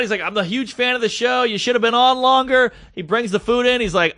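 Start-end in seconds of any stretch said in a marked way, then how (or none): 0.2-2.7 high-pitched imitated fan gushing; retold excitement, pitched well up; flipping out. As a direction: gush like a starstruck fan. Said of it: "He's flipping out".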